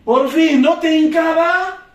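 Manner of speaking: playful loud jab at a complaining prospect; loud mock gotcha. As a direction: call them out loud with a laugh.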